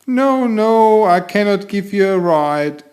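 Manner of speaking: high, put-on voice for the kangaroo mother; kangaroo lady voice. Kangaroo mother telling the cat she cannot give a ride, in a high put-on voice. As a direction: voice her as a kind kangaroo mother declining. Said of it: "she said".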